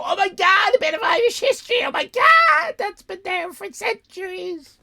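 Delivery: high, loud, fast gasping tourist wonder, soaring up; gushing tourist. Retold or imitated. Mocking tourists gawping at the statue, shrieked amazement. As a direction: ham up the starstruck tourist who thinks it's ancient. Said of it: "People look at that and come over and go".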